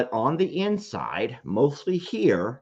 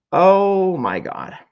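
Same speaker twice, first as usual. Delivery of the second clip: high, loud, slow cry of emotion; overcome; 8 semitones higher, 6 dB louder, much slower than usual. Real, not acted. Remembering Bailey's words, he breaks down, loud and high. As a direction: let it burst out as you break down. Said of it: "That's when I pretty much lost it".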